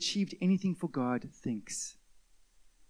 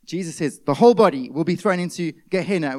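ordinary talk

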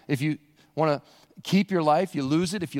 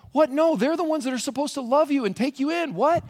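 high-pitched, incredulous protest; baffled objection to shocking news; mock disbelief; 9 semitones higher than usual. Disciples hearing who will reject Jesus, incredulous and high.

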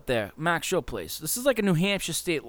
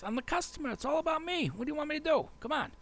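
higher, softer whiny voice of a spoiled customer; mock entitled customer; 13 semitones higher, 7 dB quieter, faster than usual. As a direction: play the self-important customer, half-mocking. Mocking an entitled beer customer.

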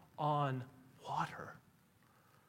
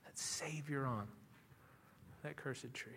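quiet reverent recitation; hushed reverence; 7 dB quieter than usual. Acted. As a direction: let the words sink in tenderly. Quoting a hymn reverently.

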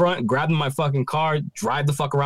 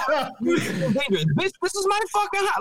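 laughing mock outrage; 12 semitones higher, slower than usual. Retelling outrage amid laughter.

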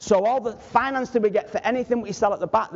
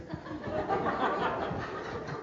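soft unvoiced chuckle; breathy laugh; 11 dB quieter than usual. Real, not acted. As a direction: laugh quietly at your own joke. Laughs at his own joke.

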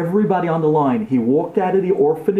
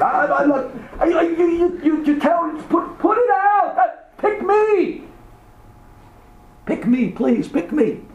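put-on stammering, high-pitched kid begging; frantic high child's plea; pleading orphan screaming; 12 semitones higher, slower than usual. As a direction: stumble over yourself pleading to be picked. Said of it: "each kid is screaming".

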